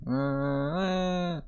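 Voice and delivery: high, soft pretend wail; mock child crying. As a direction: whine upward like a crying toddler.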